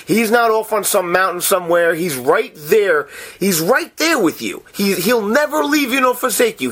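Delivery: normal talking voice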